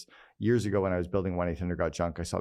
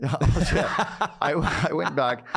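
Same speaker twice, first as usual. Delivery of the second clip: laughing out the words, high and loud; bursting into laughter mid-sentence; 8 semitones higher, 5 dB louder than usual. Real, not acted. Laughs at S1's mum remark.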